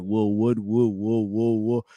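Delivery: stuck on whoa and would, mock stutter; stuttering imitation. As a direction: show the stutter taking over. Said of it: "And I'm like".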